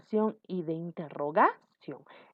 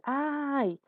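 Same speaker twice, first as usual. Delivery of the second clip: pained cry; 6 semitones higher than usual. Shown as a demonstration. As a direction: demonstrate a drawn-out cry of pain. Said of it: "ese grito de dolor". (quejido agudo de dolor fingido)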